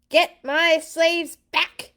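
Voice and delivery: high, slow furious screech; a tyrant screeching in rage; screeching pharaoh. Acted. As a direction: shriek the order at the army. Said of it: "he screeched".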